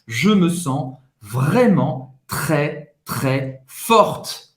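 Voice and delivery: very slow French, falling away at the end; slow dictation. Shown as a demonstration. Teacher repeats the phrase for students to write, slow dictation. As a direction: spell it out word by word for note-taking. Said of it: "Acaba a noter".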